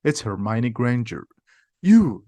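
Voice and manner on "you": sharp, higher, louder burst on one word; an angry, raised outburst. A narrator's reading. Ron rounds on Hermione as she follows them, angry. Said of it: "says Ron angrily".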